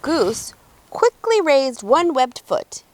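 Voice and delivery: usual speaking voice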